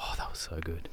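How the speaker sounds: soft breathy whisper of delight; whispered awe. Real, not acted. Co-host whispering about the great football game in awe. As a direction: whisper it like a thrilling memory.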